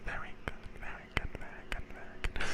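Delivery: soft whispered praise, sped up in the middle; soft whispered very good. Real, not acted. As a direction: whisper it quickly over and over.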